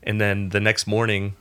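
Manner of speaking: ordinary talk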